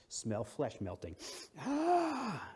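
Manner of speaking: a sharp high yelp of pain; pained cry. Pretend. Preacher acting out rope burn, sharp yelp. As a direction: cry out as the rope burns your hands.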